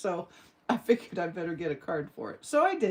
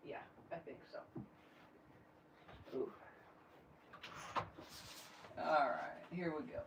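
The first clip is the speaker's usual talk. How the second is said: whispered aside; 4 semitones lower, 19 dB quieter, faster than usual. Real, not acted. Muttering to herself mid-measuring.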